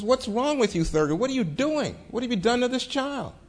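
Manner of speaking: retold scolding in high-pitched, urgent questions; alarmed reproach. Dean confronts Marshall over screaming.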